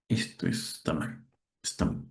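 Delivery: whispered under the breath, nearly voiceless; real whispered self-correction; muttered to self. Real, not acted. Teacher notices own mistake, self-talk.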